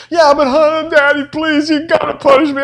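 put-on high, pleading kid voice; a child begging Daddy to punish him; whiny child.

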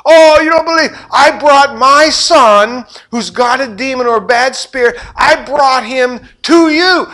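high, loud pleading yell from the crowd; desperate hollering. Retold or imitated. Father in the crowd desperately yelling to Jesus for help. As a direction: holler it across a crowd, begging. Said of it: "some guy hollers".

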